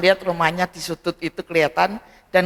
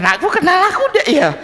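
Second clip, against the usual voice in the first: quick, higher, with a laugh in it; nervous excited thought; 10 semitones higher, 4 dB louder, faster than usual. Retold or imitated. Shaved father nervously approaching his son, laughing quick question. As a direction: giggle through the nervous question. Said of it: "Dia deg-degan juga".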